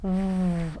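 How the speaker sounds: soft, low, sustained V buzz; isolated V sound. Shown as a demonstration. Teacher lets learners feel vibration, sustained buzzing V. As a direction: hum a long "V" with no vowel. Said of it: "ele vibra".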